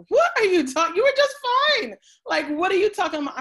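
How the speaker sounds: high, loud disbelieving outburst; incredulous mother. Retold or imitated. Mother reacting to her daughter's fear, loud and incredulous. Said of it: "looked at me like I had ten heads".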